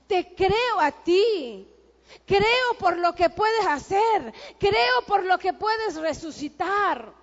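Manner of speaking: loud, slow, fervent declaration of faith; fervent shout. Acted. Preacher voices a believer surrendering to the Lord, slow fervent shout. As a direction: cry it out like a preacher at full force.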